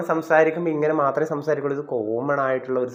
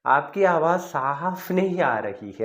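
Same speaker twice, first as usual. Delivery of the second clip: slow demo; 3 semitones higher, much slower than usual. Shown as a demonstration. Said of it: "നമുക്കെങ്ങനെ പറയാം?".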